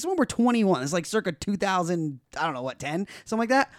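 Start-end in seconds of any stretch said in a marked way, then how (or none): none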